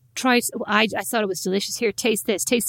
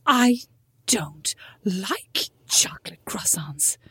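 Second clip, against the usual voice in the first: whispered, slow, mock refusal; 2 semitones lower, much slower than usual. Imitating Mom refusing the croissant, in a whisper. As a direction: breathe it out like a fussy mum.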